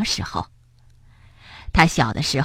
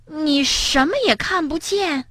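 higher, astonished question; surprised; 7 semitones higher than usual. Acted. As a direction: ask in disbelief. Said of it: "跳跳惊讶地问".

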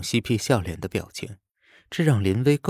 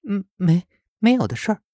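put-on high, flustered girlish denial; 11 semitones higher, 4 dB louder than usual. A narrator's reading. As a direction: stammer it out, caught out and blushing. Lin Wei denies, embarrassed.

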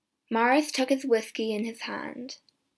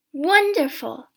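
loud, higher cry of delight; excited exclamation; 5 semitones higher, 9 dB louder, slower than usual. A narrator's reading. Son hearing of three wishes, excited exclamation. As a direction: burst out with delight at it.

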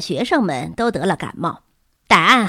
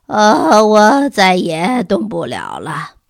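loud groan sinking into slow, hoarse words; 6 dB louder, much slower than usual. A narrator's reading. Collapsed teacher telling Miss Peabody he's finished, hoarse. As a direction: drag it out, utterly spent. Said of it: "他声音沙哑地对皮波提小姐说道".

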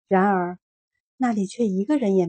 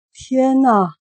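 surprised gasp; 4 semitones higher, 8 dB louder than usual. A narrator's reading. Voice exclaims in surprise.

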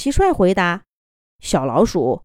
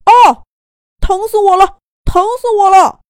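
loud, high cry of pain; shouting while wounded; 12 semitones higher, 10 dB louder than usual. A narrator's reading. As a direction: yell out from a throbbing head. Donkey wakes in pain. (疼得大叫)

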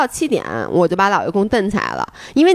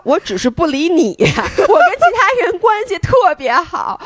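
laughing comeback; 7 semitones higher, slower than usual. Retold or imitated. Hiker retells snubbing the annoying teammate, laughing comeback. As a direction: throw the comeback out through laughter, pitch climbing.

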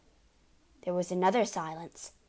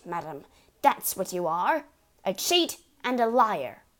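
angry rant; 6 dB louder than usual. Acted. Father's ranting accusation.

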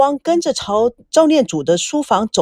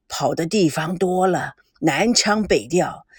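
put-on slow, low old man's voice, softer; an elderly grandfather probing slowly, lower; 5 semitones lower, 4 dB quieter than usual. A narrator's reading. Grandfather questions granddaughter's boyfriend, slow and low.

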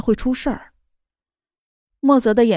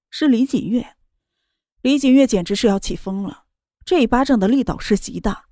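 plain reading voice

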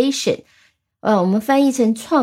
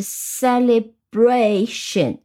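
slow "celebration", syllables apart, bra held; stress on BRA; much slower than usual. Shown as a demonstration. Teacher demonstrates the rule, slow "celebration" stressed on bra. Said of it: "重音是".

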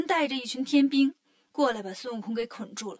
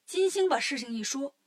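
usual speaking voice

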